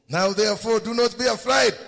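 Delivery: high, loud preacher's call; emphatic proclamation. Acted. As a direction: punch each word out to the congregation. Preacher proclaims Joseph's reassurance.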